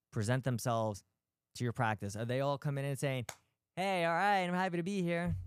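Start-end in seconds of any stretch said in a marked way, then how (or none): 3.8-5.4 higher-pitched mock-happy greeting; mock cheerful patient. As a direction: put on a lightly chipper patient's hello. Imagined patient arriving in great mood.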